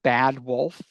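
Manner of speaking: ordinary talk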